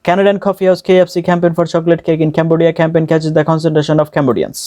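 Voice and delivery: connected tongue-twister run without pauses; all run together. Shown as a demonstration. Teacher shows the whole thing at once, run together. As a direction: roll straight through in one even stream. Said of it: "আবার শুনেন".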